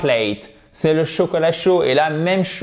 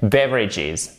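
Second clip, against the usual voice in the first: "beverages" with a leaning BEV, falling away; "beverages" as BEV-ridges, ending low; exaggerated tonic stress; 3 semitones lower than usual. Shown as a demonstration. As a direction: lean on the first syllable of "beverages". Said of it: "exagérer quand vous prononcez".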